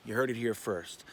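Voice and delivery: ordinary talk, nothing marked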